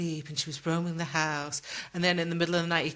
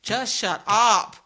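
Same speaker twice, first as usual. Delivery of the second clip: much louder and slow, fed up; exasperated snap; 3 semitones higher, 8 dB louder, much slower than usual. Retold or imitated. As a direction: bark it out slowly, out of patience. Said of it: "And I'm like".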